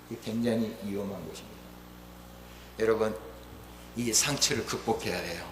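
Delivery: ordinary delivery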